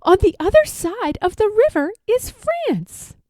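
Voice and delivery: put-on a girl showing her dog France; excited young girl. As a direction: point it out to the dog with a girl's eagerness.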